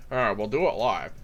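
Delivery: low, slightly missed newsman impression; a lightly put-on low presenter voice; Chris Hansen. Comedian tests a broadcaster voice, light Chris Hansen impression. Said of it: "that's more my Chris Hansen impersonation".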